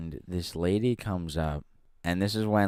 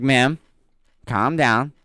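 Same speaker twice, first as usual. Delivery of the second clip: higher, louder soothing plea; calming the customer; 5 semitones higher, 8 dB louder than usual. Retold or imitated. Clerk calming the panicking customer, mock soothing. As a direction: talk her down, a touch higher and louder. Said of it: "I'm like".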